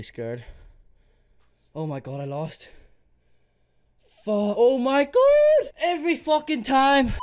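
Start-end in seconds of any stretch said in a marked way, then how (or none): 4.2-7.1 screamed much higher and louder in real frustration; furious yell. Real, not acted. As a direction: yell it out after losing yet again. Loses the battle again.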